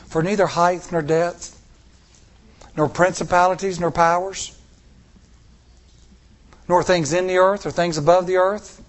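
loud, high declamation; preaching scripture. Acted. Preacher proclaiming scripture to congregation, loud and high. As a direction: proclaim it like a herald. Reciting scripture at full force.

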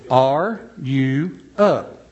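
ARE, YOU, UP slowly, one at a time; word broken apart. Shown as a demonstration. Explaining the new Southern word, slowly broken apart. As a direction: separate Are you up with long pauses. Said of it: "that's a great word".

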